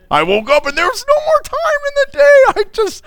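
high-pitched, hammed-up whiny excuse; squeaky mock helplessness; mock excuse-making. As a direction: plead a ridiculous excuse in a high voice. Acts out an absurd excuse.

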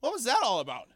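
mock-baffled wife, pitch falling away; sitcom wife. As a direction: deliver it like a sitcom punchline. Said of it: "like a sitcom".

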